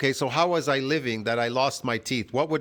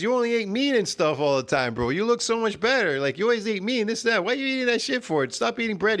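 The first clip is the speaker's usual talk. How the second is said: higher, fast, exasperated friend voice; pitched-up mock scolding from a friend; imitating his friend; 7 semitones higher, faster than usual. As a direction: rattle it off like a nagging buddy. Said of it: "he goes".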